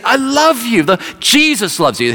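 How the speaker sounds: imitated evangelist calling out, high-pitched; street preaching. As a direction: cry it out to a passer-by. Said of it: "He's preaching like this on the streets".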